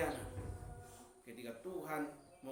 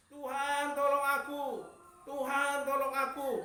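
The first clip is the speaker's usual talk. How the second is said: higher, louder mock plea to God; pleading prayer; 10 semitones higher, 6 dB louder than usual. Believer in trouble begging God for help, loud mock plea. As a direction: cry out to God for help, hammed-up.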